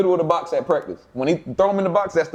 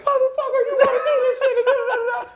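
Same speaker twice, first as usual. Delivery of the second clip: much higher, slow, laughing mock coach yell; imitating Saban; slower than usual. Saban challenging the redshirting freshman, in a high laughing mock yell. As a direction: lampoon the head coach squeaking through laughter. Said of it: "Here goes Saban".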